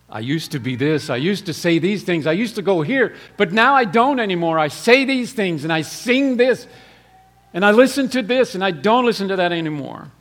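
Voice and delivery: building from plain to loud fervent preaching. Acted. Preacher testifying to the congregation, building to a loud fervent peak.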